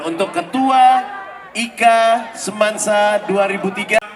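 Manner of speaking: slow, high, booming MC announcement; booming prize announcer. Acted. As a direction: call the name out like a game-show host. MC announces the chairman.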